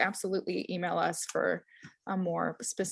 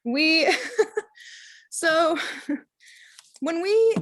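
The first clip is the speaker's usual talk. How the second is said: laughing; 8 semitones higher, 5 dB louder, much slower than usual. Real, not acted. Laughing while restarting her sentence.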